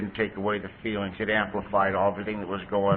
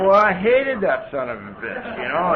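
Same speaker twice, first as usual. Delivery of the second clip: laughing; 7 semitones higher, 5 dB louder than usual. Real, not acted. Laughing about old enemy Charlie.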